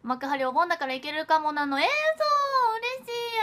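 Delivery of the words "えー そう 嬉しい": high stretched gasp of joy; light up at the good news; delighted surprise. Real, not acted. Idol thrilled that a fan can come.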